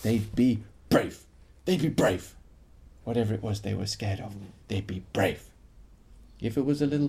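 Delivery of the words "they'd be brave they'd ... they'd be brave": reassuring refrain. A narrator's reading. Storyteller promising scared children they'll be brave. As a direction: say it like a promise, low and steady.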